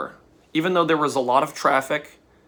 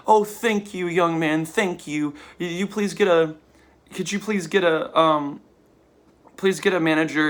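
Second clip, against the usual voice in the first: higher-pitched, halting, grateful woman's voice; imitated anxious woman; 4 semitones higher than usual. Woman thanking the young man who walked her over, imitated halting plea. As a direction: mimic her high, faltering thanks and request. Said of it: "she was like".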